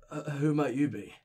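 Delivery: put-on slurred town drunk. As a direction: talk like a boozy old greeter. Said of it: "giving my drunk voice".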